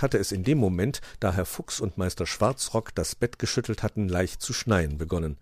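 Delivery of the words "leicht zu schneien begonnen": usual speaking voice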